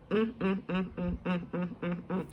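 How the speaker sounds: tut-tut in humming, fading away; disapproving hum. Real, not acted. Disapproving hums.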